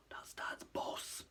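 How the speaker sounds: very slow, hushed whisper; breathed whisper. Acted. Ron hiding with Harry, secretly whispering. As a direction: whisper it under your breath. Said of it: "Ron breathed".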